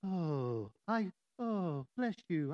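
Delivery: low, hushed, pious parody; hammed-up emotionless blessing; mock saintly. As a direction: sigh it out as an exaggeratedly serene saint. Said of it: "I thought you had to be like".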